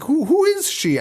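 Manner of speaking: very high, stammered puzzlement; retold bafflement. Viewer who couldn't figure it out, high mock bafflement. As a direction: squeak it out in exaggerated confusion. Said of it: "I couldn't figure it out".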